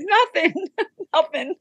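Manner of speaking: high, laughing brush-off. Retold or imitated. To her sister, laughing she needs nothing. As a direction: laugh off the offer of help.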